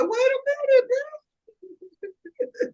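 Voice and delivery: high, put-on diva voice; mock sassy retort. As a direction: snap back like a diva. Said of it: "B Simone made a post like".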